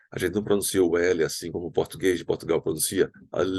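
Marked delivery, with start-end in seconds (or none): 3.3-3.5 Al with a real tongue-tip L; pronounced L. Shown as a demonstration. Teacher to Brazilian students, Al with a sounded L. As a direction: hold the L of Al, never a W glide. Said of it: "temos que pronunciar o L".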